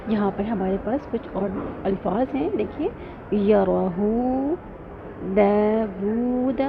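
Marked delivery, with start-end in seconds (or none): 3.4-6.6 یراہو داود with long vowels drawn out very slowly; elongated recitation. Shown as a demonstration. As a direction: hold each madd. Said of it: "یہاں پر دیکھیے اس لفظ میں". (دو حرکہ کھینچ کر)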